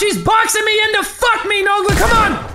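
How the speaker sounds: high, frantic yell for backup; panicked shouting. Real, not acted. Player boxed in, calling teammate over, panicked shouting. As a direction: plead loudly, getting desperate.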